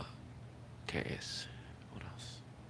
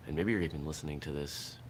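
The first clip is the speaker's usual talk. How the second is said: ordinary talk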